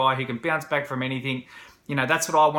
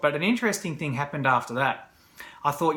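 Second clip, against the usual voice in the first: ordinary delivery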